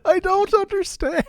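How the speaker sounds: very high laughing shriek of frustration; shrieking disbelief. Real, not acted. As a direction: break into a squeal at the absurd result. Failure again, shrieking laughter.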